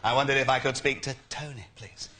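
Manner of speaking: lightly voiced Hague, quick and polite; a hint of a formal Tory leader asking for Tony; William Hague impression. Prank caller as William Hague asking the switchboard for Tony. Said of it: "I just perfected the William Hague impression".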